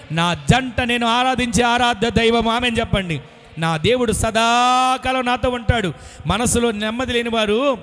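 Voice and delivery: loud, rising call-and-response preaching; preaching at full force. Acted. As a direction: drive the congregation to say amen. (బిగ్గరగా ఉద్వేగంతో ప్రసంగం)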